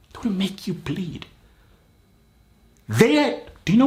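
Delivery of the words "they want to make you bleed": whisper. Acted. Pep talk, repeated as whisper.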